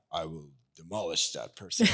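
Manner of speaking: low, mock-menacing protector; put-on deep voice, playing the avenging guy; tough-guy voice. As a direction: drop into a deep macho threat. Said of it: "in that voice too".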